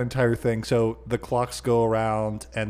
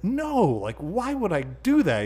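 taken aback; 9 semitones higher, slower than usual. Retold or imitated. Acting Peter, taken aback.

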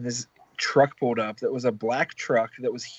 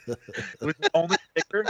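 laughing; 4 semitones higher than usual. Real, not acted. Laughing while telling.

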